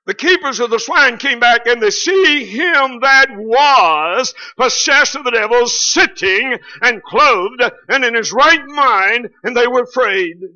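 loud, slow, high pulpit preaching; preaching at full force. Acted. Preacher pounding the point home to the church, loud and high. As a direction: lift the congregation with a big rising sermon voice.

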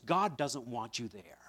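ordinary talk, nothing marked

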